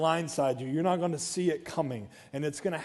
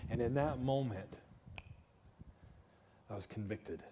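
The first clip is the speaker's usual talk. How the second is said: ordinary delivery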